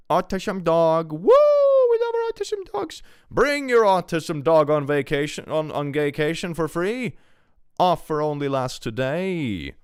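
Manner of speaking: high, loud, hammed-up sales pitch; mock advert announcer. Streamer pitches a fake dog holiday offer, hammed-up announcer. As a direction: whoop it up like a silly commercial.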